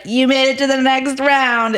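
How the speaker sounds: high, sustained mock announcement; host's surprise reveal. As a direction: sing out the good news like a TV host.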